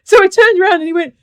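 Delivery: high, loud and fast, laughing through it; laughing retelling. Real, not acted. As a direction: tell it while cracking up. Laughing retelling of the scary man.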